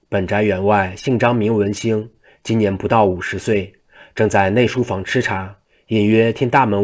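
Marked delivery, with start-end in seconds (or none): none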